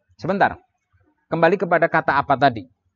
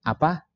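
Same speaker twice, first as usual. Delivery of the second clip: short "apa?" as a simple question; questioning intonation; 2 semitones higher than usual. Shown as a demonstration. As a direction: ask "apa" briefly at an everyday pitch. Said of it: "Dengan intonasi yang berbeda".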